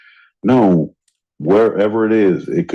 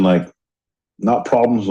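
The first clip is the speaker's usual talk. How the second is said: usual speaking voice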